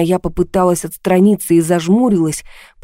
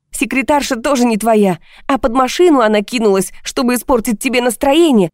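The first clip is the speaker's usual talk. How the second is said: high-pitched, scathing tirade; mock the excuses with furious sarcasm; bitter, heated outpouring; sarcastic shouting; 7 semitones higher than usual. A narrator's reading. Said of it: "теперь и я кричала".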